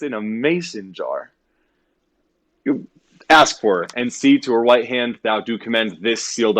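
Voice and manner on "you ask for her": usual speaking voice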